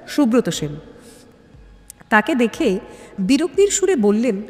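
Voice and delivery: ordinary delivery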